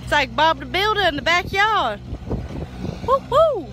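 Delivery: high, loud whoops of delight; excited shouting. Real, not acted. Calling out to Walker at the backyard pipe work, thrilled and loud.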